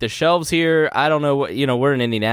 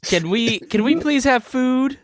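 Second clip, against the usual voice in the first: put-on high, slow pleading child; whiny kid voice; 11 semitones higher, slower than usual. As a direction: beg like a hungry kid. Voicing kids begging for food.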